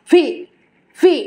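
"ভি" pushed from inside with force; forceful V. Shown as a demonstration. Teacher models correct V, forceful "ভি". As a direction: drive the v of "ভি" hard. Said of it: "আমরা correctly বলবো".

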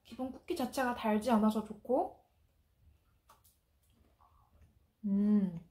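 talking with food in mouth; biting into cookie; chewing. Real, not acted. Seen 0: bites cookie at -1.0 and +2.3 to +3.6, chewing between.

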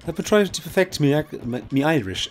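high, loud mock Irish brogue; playful Irish accent. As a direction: put on a hammed-up Irish voice. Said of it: "my Irish accent".

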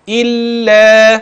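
إلا drawn out, lam held long; slow demo of the lam. Shown as a demonstration. Teacher pointing out the lam again, slow drawn-out recitation. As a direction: lean on the lam in إلا slowly. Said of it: "أدي اللام تاني اهي".

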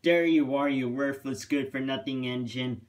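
ordinary delivery, nothing marked

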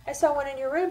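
high-pitched, lightly excited objection; exclaiming. A narrator's reading. Tina contradicting her friend about the room, exclaiming. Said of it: "Tina exclaimed".

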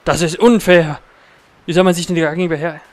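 panisch und laut schreiend; in Panik vor den Wölfen rufen; being chased. Real, not acted. Wölfe greifen im Spiel an. (gejagt, panisch schreiend)

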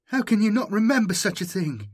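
put-on the boy shocked by the answer; incredulous. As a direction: recoil at the strangeness. Jomi incredulous.

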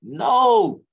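a high, loud no; emphatic denial. Retold or imitated. Him denying the rumour to companies, high and loud.

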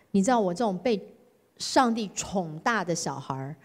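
ordinary talk

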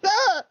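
short, high, loud yelp; mock startled shriek. Player acting the character's start at the gate, mock yelp. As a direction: let out a startled little shriek. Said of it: "to see if Steve actively shrieks".

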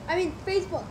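normal talking voice

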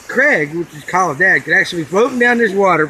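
usual speaking voice

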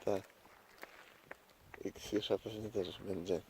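ordinary talk, nothing marked

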